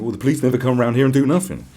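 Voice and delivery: fast, loud indignant grumble; mock complaining. Mocking neighbours griping about police, quick and loud. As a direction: rattle off the complaint in a huff. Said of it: "the next minute they're going".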